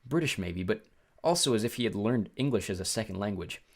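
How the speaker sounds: plain reading voice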